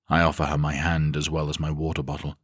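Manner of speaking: usual speaking voice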